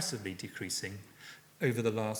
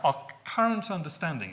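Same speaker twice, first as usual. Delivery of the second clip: current picked out higher and louder; stress on current; 5 semitones higher, 4 dB louder than usual. Real, not acted. Lecturer cautioning that understanding may change, stress on current. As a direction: lean firmly on the word current. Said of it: "I want to stress the word current".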